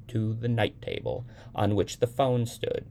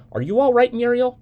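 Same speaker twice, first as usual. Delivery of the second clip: much higher and louder, almost shouting; anxious shout; 9 dB louder than usual. A narrator's reading. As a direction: cry it into the phone in alarm. Mother asks again, louder.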